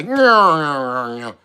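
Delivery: imitated off-key game music; low, wobbly nyah-nyah mimicry; off-key noise. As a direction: whine out a warped tune. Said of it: "some off-key shit".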